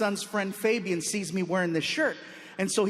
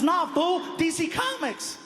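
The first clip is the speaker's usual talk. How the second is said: high, slow mocking "Nah, fool"; mock teen; 7 semitones higher, slower than usual. Fabian correcting the comedian, high and scornful. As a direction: talk down to him like a know-it-all teen. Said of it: "He goes".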